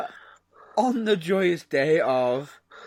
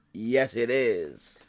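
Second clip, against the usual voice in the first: normal talking voice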